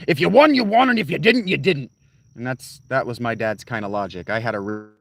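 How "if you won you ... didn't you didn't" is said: high, loud announcer-style quote; mock epic voiceover; imitating a dramatic voiceover. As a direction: belt it like a hyped game trailer narrator. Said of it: "Duralius does this voiceover where he says".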